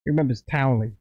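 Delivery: ordinary delivery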